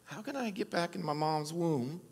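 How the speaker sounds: hushed mock bewilderment; imitating puzzled Nicodemus. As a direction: mumble it as if thinking aloud. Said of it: "Were you like Nicodemus going".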